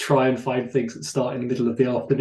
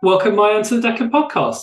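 bright, much higher and louder host welcome; big on-air welcome; 8 semitones higher, 5 dB louder than usual. Acted. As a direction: throw open the doors for the guest. Host greeting the guest on air.

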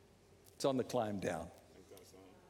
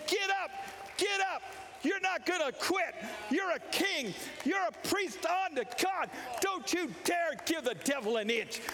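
self-pep shout; 11 semitones higher than usual. Retold or imitated. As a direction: shout yourself up off the floor. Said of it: "start talking to yourself and saying".

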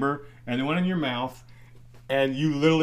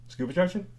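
laughing disbelief; 7 semitones higher than usual. Retold or imitated. Retold coach hearing odd phrase.

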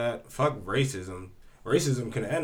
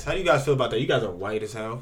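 ordinary delivery

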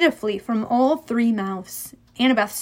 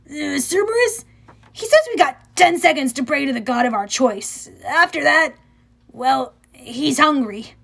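hesitant, lightly raised voice; nervous satyr friend; 3 semitones higher than usual. A narrator's reading. Grover translates the dog's threat to Percy, uneasily. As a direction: relay bad news haltingly.

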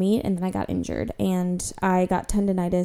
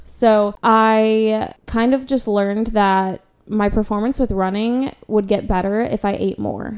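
ordinary delivery

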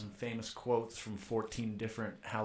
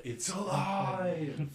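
slow mad scientist cry; 8 semitones higher, 3 dB louder, much slower than usual. Acted. Player shouts Frankenstein line.

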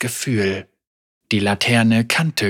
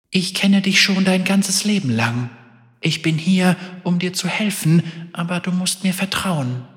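higher, gentle and fragile; melodic sad voice; 7 semitones higher than usual. Acted. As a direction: soothe her like a sad friend. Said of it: "melodisch, traurig".

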